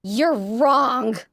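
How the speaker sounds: a strangled, tearful defiance; choked loud outburst. A narrator's reading. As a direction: choke it out, pushed hard. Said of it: "I choke out as loud as I can".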